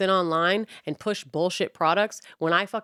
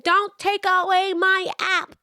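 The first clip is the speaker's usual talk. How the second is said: high, slow whiny mock plea; mocking protester; 11 semitones higher, 4 dB louder, slower than usual. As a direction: parody a teary protester.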